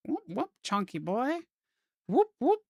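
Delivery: high rising "whoop, whoop"; little whoops leaping upward in pitch; chonky boy sound effect. Pretend. Artist voicing chonky letters while drawing, playful whooping sound effect. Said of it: "That's the sound of chonky boys".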